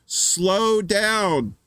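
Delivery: acted cab driver's yell; mock yell. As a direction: yell it across to him, drawn out. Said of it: "he yells to him".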